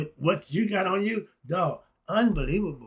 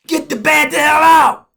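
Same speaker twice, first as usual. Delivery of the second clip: furious shout; 10 semitones higher, 14 dB louder than usual. Acted. Shouting at the bad politicians, full-voiced and loud. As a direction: yell it out at the top of your lungs.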